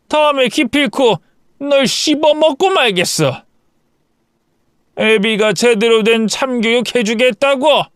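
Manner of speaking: high-pitched furious ranting; yelling father-in-law. A narrator's reading. Father-in-law being arrested, yelling threats at the couple. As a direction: spit threats as he's dragged away. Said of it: "시아버지는 소리를 고래고래 질렀지만".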